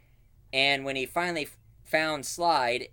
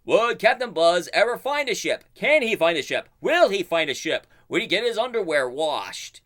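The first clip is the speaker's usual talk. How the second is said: higher, louder announcer teaser; dramatic narrator; 5 semitones higher, 6 dB louder than usual. Retold or imitated. Cartoon narrator teasing the next episode, hammed-up. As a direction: ham up the cliffhanger questions. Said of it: "the narrator's going like".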